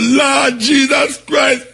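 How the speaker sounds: drawn-out high cry of Lord Jesus Christ; crying out to God. Retold or imitated. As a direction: wail it slowly to the heavens.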